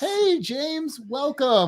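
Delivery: high, playful welcome; high-pitched greeting. Pretend. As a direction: greet the arriving guest way up high. Greeting a guest in put-on voice.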